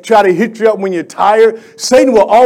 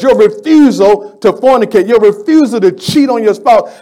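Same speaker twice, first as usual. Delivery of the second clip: louder, hammering each "refusal"; full-force preaching; 5 dB louder than usual. Acted. Preacher rousing the church about refusing sin, fervent and louder. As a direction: drive the list home to the congregation. Preacher builds a list to a climax.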